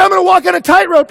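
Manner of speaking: daredevil announcement yelled at full volume; high shout. Acted. Daredevil announcing a tightrope walk, shouting. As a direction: yell it high and fast. Repeats the stunt claim, shouting.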